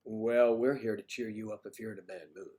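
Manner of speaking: ordinary talk, nothing marked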